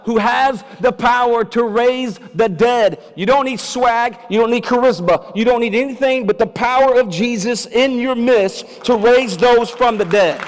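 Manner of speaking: loud, high preacher's cry, slowing at the end; full-force preaching. Acted. Pastor to congregation, preaching at full force. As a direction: preach it out to the whole church.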